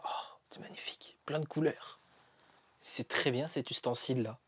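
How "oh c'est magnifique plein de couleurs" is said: hushed, breathless whisper of wonder; soft marvelling whisper; awed whisper. Real, not acted. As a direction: whisper it like a secret treasure. Marvelling at the blinking LED light.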